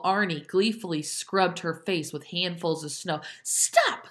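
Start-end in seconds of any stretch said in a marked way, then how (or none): 3.4-4.1 very high yelped scream. A narrator's reading. Dicey held against a tree, high scream. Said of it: "screamed Dicey".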